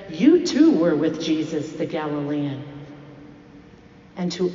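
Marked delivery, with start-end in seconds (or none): 0.1-2.6 louder accusing challenge; challenging. Acted. Servant confronting Peter by the fire, challenging. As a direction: hit "You too" hard as an accusation. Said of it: "first one to challenge him".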